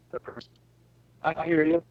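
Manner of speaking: ordinary talk, nothing marked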